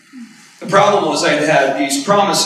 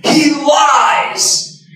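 sudden high loud outburst; yelling; 13 semitones higher, 5 dB louder than usual. Retold or imitated. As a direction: explode with a pained yell.

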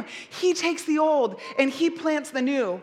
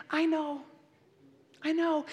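put-on soothing a baby; 5 dB quieter than usual. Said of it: "they're just".

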